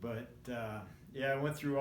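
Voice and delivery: ordinary talk, nothing marked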